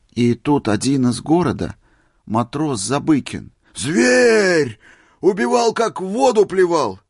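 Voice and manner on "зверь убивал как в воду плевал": very high, loud outcry; agitated exclaiming. A narrator's reading. Old man describing the killer sailor, agitated. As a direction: cry out in horrified excitement.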